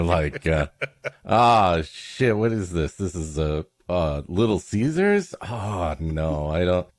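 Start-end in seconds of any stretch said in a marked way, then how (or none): none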